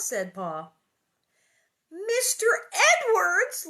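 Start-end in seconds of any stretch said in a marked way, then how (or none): 1.9-3.7 high, loud, drawn-out name; excited shout. A narrator's reading. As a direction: shout it slowly in joyful astonishment. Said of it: "Laura shouted".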